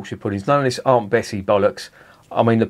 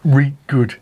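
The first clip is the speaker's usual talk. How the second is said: "reet good" with the Yorkshire vowel, a bit louder; Northern reet good; 3 semitones higher, 4 dB louder than usual. Acted. Reading the label, mock Yorkshire "reet good". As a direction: punch "reet" in a light Yorkshire accent. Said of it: "They, that's not me, they say reet good".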